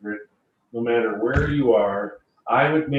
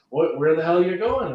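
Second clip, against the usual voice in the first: higher and louder, a retold irritated snap at 'going'; mock angry customer; 6 semitones higher, 5 dB louder than usual. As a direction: play the fed-up client yelling after him.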